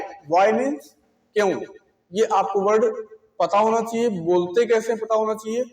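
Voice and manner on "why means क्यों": normal talking voice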